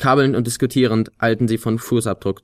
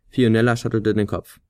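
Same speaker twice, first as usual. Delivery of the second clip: plain reading voice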